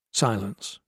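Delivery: ordinary delivery, nothing marked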